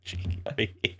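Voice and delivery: breathy, near-whispered British take on "Cheeky breeky"; lazy UK voice actor. Retold or imitated. Host mocking a random UK voice actor, half-whispered British accent. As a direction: toss off "Cheeky breeky" like a hired Brit reading a line. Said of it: "some random, like, U K voice actor".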